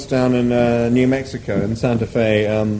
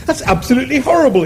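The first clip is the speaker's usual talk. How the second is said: much higher, fast outraged exclamation; exclaimed; 12 semitones higher, faster than usual. Acted. Arthur reacts to the offer with a high fast exclamation. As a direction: recoil in disgust. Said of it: "exclaimed Arthur".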